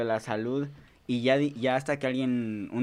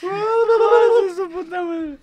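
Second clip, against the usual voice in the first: high, loud, wailing cry; mock-terrified outburst; startled wail; 10 dB louder, much slower than usual. Real, not acted. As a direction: yelp it out like you've been spooked. Startled exclamation, laughing wail.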